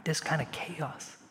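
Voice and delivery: a hushed whisper. Real, not acted. Preacher naming the chaos to the congregation, whispered. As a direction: let the words fall to a breath. Sombre climax on suffering.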